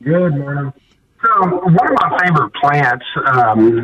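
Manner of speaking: muffled bad phone line; garbled cell phone sound; underwater. Real, not acted. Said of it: "sounds like you're underwater".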